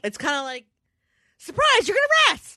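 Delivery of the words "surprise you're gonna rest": high, bright sing-song "surprise"; mock cheerful announcement. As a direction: announce it like a cheery game-show host.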